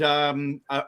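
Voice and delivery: ordinary talk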